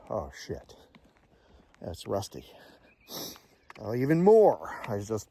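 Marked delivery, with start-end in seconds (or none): 0.1-2.5 much lower, soft, hollow realisation; quiet dawning horror. Retold or imitated. Staring at his roommate's family photos, low stricken murmur. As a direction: say it to yourself as the truth sinks in. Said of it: "And I'm thinking".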